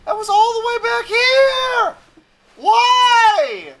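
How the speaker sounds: high, loud wail of protest; exasperated shout. Real, not acted. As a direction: holler it in outraged disbelief. Protests the narrator's order.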